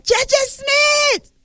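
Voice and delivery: high-pitched fan's holler; stretched, high mock yell; imitated shout. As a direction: call the name out high, imitating an excited fan. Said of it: "That one don't shout".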